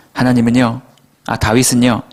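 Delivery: usual speaking voice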